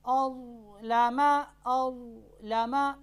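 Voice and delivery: अज़लमा with a heavy ज़ that is not bounced; ज़ held still. Shown as a demonstration. Teacher demonstrating the sakin ज़ without qalqala. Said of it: "देखिए मैंने कैसे पढ़ा".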